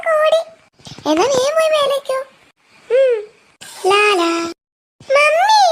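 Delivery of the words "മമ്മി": loud call to mum from another room; child hollering for her mother; yelling for mum. Acted. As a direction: shout out for mummy. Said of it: "എന്താ പെണ്ണിങ്ങനെ അലറുന്നേ".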